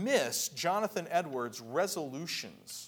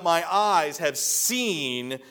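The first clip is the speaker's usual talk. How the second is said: loud, higher-pitched proclamation from the pulpit; crying out; 5 semitones higher, 13 dB louder, slower than usual. Acted. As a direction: cry it out slowly, swelling on the last words. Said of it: "Simeon cries out".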